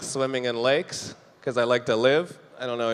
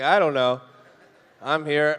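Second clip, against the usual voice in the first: ordinary talk, nothing marked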